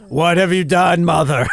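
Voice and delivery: loud mock outraged dog shout; the dog yells at its owner; hammed-up furious pet; voicing the dog.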